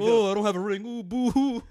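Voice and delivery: high, loud mock whimper; fake crying. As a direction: fake boo-hoo sobbing, laughing. Mocking player without a ring.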